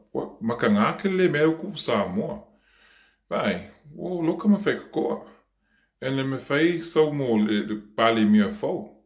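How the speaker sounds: high-pitched, animated account to mum; acted agitated telling. Child tells mum about Samoa's trouble, acted agitated voice.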